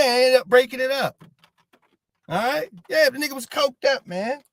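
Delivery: normal talking voice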